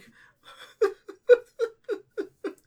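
high, gasping sobs in bursts; squeaky, stuttering acted sob; acted weeping. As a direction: break into loud wordless weeping. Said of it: "he starts, like, weeping".

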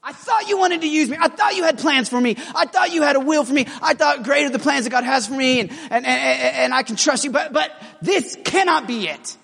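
high, pleading outcry to God; anguished plea to God. Pretend. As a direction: cry out to God, hurt and pleading. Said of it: "he's gotta be like".

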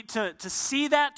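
usual speaking voice

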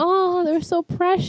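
high, drawn-out adoring coo; melting with cuteness, slow and high-pitched; gushing coo. Retold or imitated. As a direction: coo over an adorable couple. Gushing over a sweet couple.

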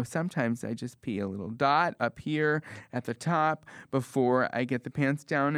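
ordinary talk

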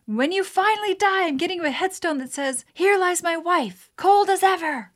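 high, loud mock-angry yell; mock yell at wife. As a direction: hurl the insult in a hammed-up fight. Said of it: "I yelled at her".

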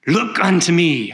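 put-on old lay preacher crying out, pitched high; old shoemaker preaching. Shoemaker preaching his text to a tiny church, impassioned high cry. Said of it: "in his thick Essex accent".

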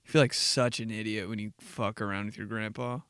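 usual speaking voice

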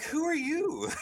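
high-pitched, laughing disbelief; mock-bewildered question to a stranger; baffled exclamation. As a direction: blurt it way up high, breaking into a laugh. Retelling their bafflement at a stranger.

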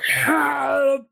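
high, drawn-out cry for help; anguished, long high-pitched wail; anguished scream. Acted. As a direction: scream it out like a creature in agony. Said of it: "screams and is like".

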